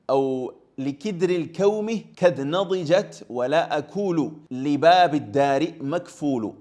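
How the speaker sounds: a lightly chanted classical Arabic verse; poem recitation. Acted. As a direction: recite the verse with a steady rhythm.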